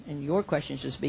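normal talking voice

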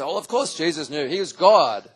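loud, matter-of-fact imitation of eager students; knowing students. Pastor voices Bible school students stating the obvious, loud and sure. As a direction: toss it off as obvious. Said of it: "they would say".

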